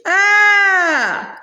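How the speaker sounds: sharp high pained scream; goblin scream. A narrator's reading. Hobgoblin caught in the griffin's beak, a sharp high scream. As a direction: cry out as the beak clamps down. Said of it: "Hog Squeal screamed".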